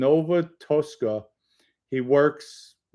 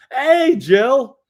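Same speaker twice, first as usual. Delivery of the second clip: excited greeting; 11 semitones higher, 6 dB louder than usual. Real, not acted. Greeting returning viewer loudly.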